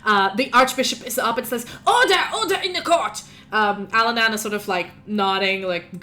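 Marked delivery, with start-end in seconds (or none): 1.9-3.2 high, forceful call to order. Acted. Archbishop calling the court to order, high and loud. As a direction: bang the gavel with your voice.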